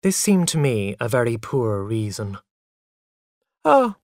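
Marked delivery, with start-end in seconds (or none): 3.6-3.9 high, light "oh"; lightly dismissive. A narrator's reading. Boy shrugs off mother's reason, lightly. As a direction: brush it off airily. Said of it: "I said lightly".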